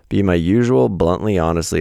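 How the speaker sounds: usual speaking voice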